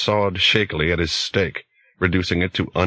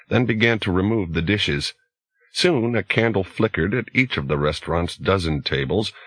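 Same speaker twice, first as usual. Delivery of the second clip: usual speaking voice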